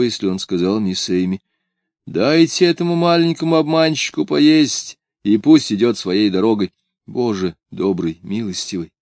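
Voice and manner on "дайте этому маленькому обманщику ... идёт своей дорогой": higher, lofty order; imagined dismissal. A narrator's reading. Father ordering Miss Amy to send the boy away, dismissive. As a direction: send the little impostor off, pitch raised.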